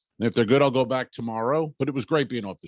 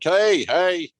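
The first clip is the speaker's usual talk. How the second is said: loud high call of hey; shouting after a departing customer; calling him back; 8 semitones higher, 5 dB louder than usual. Retold or imitated. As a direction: call out after someone walking away.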